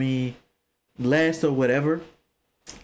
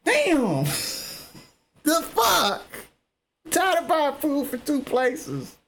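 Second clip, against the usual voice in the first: high, slow fed-up complaint; mock annoyed at missing food, high and slow; exasperated outburst; 12 semitones higher, slower than usual. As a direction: act fed up with buying food twice. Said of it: "And I'll be like".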